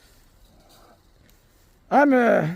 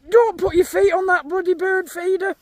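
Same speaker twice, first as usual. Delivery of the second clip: higher, sharp telling-off; scolding; 6 semitones higher than usual. Real, not acted. Farmer scolding a Highland cow near the bird feeder.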